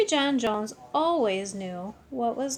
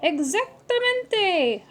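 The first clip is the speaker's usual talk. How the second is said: howled; 8 semitones higher, 5 dB louder, slower than usual. Acted. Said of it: "howled the doggies".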